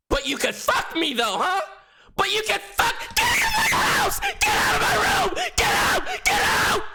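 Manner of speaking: high, furious shrieking imitation of her; screaming. Girl throws him out of her house, imitated screaming. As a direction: scream it at the top of your voice. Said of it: "screaming like this bitch".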